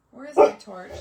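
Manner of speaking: sudden loud, exasperated call; calling out for torch. Real, not acted. Crafter hunting her room for the torch, loud exasperated call. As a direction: blurt it out loud in frustration.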